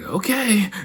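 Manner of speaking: high, drawn-out okay from the driver; resigned driver okay. Retold or imitated. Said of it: "he's just like".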